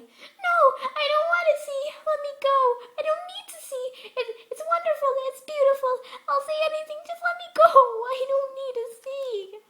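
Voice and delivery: shrill, very loud desperate shrieks; panicked begging. A narrator's reading. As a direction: scream and plead in hysteria.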